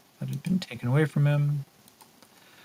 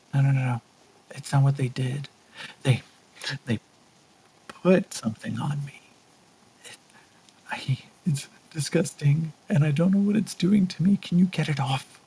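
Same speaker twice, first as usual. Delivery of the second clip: put-on frightened young woman; 2 semitones higher than usual. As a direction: sound panicked about something on her chest. Frightened girl NPC voiced by GM.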